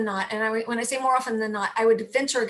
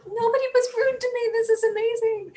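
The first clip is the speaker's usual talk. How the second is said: much higher, wide-eyed mock first-timer; amazed newcomer; 12 semitones higher, 3 dB louder than usual. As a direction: play the astonished rookie volunteer. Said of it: "and they're like".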